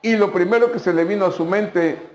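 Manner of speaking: ordinary talk, nothing marked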